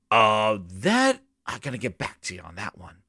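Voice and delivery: drops low and soft, trailing off evasively; mock a boss ducking the question, going quiet; mumbled dodge. Gamer mocking Phil dodging the question, mumbled and low.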